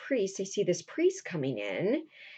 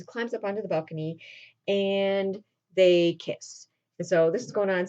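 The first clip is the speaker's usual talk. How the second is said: ordinary talk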